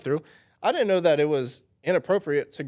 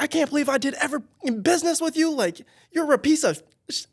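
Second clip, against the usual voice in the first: much higher, louder, enraged yelling; furious rant; 14 semitones higher, 3 dB louder than usual. Retold or imitated. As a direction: impersonate a furious man going off. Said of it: "going off me".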